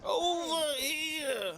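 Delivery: high, drawn-out echo of a shout; exaggerated copy of someone calling over; mimicked call. Echoing a game character calling out.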